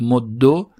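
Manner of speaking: "مدو" ending in a short "o"; damma ending. Shown as a demonstration. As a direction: say "مدو" as moddo, doubled d. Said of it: "هم ضمه بگیره".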